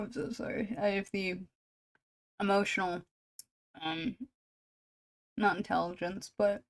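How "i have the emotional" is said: ordinary delivery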